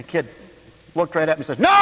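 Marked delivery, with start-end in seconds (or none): 1.6-1.7 put-on loud, high kid's refusal; defiant child shout. Boy refuses to spit out the candy, defiant shout.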